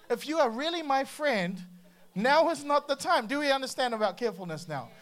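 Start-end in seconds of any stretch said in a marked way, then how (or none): none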